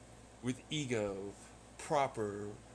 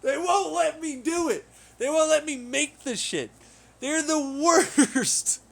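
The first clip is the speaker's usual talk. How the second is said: angry complaint; 10 semitones higher, 9 dB louder than usual. Real, not acted. Complaining angrily.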